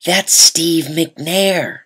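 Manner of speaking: drawn-out, know-it-all mom; mom showing off. Retold or imitated. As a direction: mom showing off her sports knowledge to her son. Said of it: "she wanted to show off her sports knowledge too and said".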